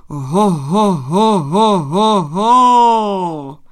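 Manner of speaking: long chain of booming "ho" calls; very loud swelling horn-like hoots; thunderous holler. A narrator's reading. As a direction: sound it like a forest horn, holding the last. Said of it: "Znělo to jako hrom".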